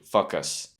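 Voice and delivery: Fuck us, short vowel where long O belongs; mispronounced focus. Shown as a demonstration. Teacher warning that it sounds rude, mispronounced. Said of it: "that sounds quite rude".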